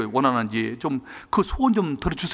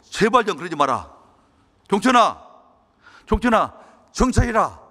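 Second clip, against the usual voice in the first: angry father; 6 semitones higher, 4 dB louder than usual. Retold or imitated. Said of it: "아버지가 막 화를 내시면서".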